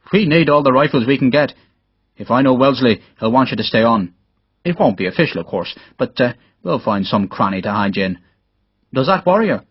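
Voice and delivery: brisk Irish officer taking a man under his wing; Irish captain. Acted. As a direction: play a friendly Irish captain, confiding a plan.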